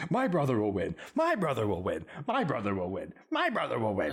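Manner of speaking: half-hearted mock squawk, each line swooping down; mock screaming. Retelling a cartoon battle of words, mock screaming. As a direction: imitate a screaming bird without really yelling. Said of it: "by just screaming".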